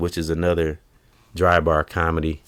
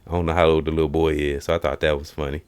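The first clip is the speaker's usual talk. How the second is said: ordinary talk, nothing marked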